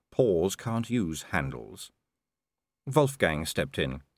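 ordinary delivery

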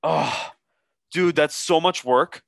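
slow, put-upon mock complaint; imitated reluctant bartender. Bartender refusing to cut a lime, imitated reluctant groan. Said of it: "And he goes".

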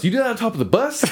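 high, fast mocking cop voice; mock Southern cop. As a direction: rattle it off like a sneering cop. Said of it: "fantastic racist cop vibe".

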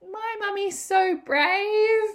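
put-on high, slow, loud child's voice, full of wonder; little boy. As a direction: say it like an adoring small son. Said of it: "he was like".

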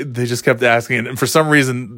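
ordinary delivery

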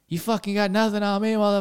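high, cocky taunt at the cops; mock a tough guy daring the cops; defiant taunt. [name] mouthing off to the cops, high cocky taunt.

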